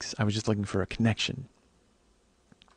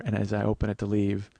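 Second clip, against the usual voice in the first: ordinary talk, nothing marked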